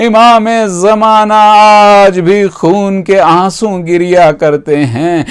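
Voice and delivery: impassioned, drawn-out mourning oratory; majlis lamentation. Acted. Preacher grieving to mourners over the captive women, impassioned lamentation. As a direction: cry out the lament, stretching the key words slowly.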